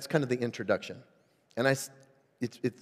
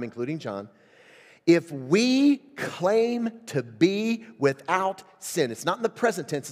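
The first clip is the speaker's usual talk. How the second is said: impassioned preaching; 4 semitones higher, 4 dB louder than usual. Acted. Pastor preaching First John to his church, raised and emphatic. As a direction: drive the scripture home.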